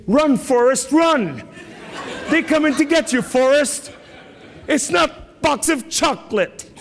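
very high, hammed-up movie-quote cry; mock alarmed warning. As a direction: send a comic warning way up high. Parody warning from Forrest Gump film.

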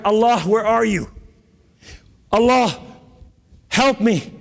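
high, pleading call for help; retold desperate cry. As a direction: re-enact crying out to heaven. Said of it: "And I cried".

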